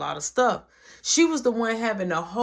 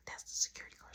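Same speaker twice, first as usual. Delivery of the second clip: urgent retold whisper; hushed warning; 17 dB quieter, much faster than usual. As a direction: whisper a warning so the guard won't hear. Hushed warning as guard knocks.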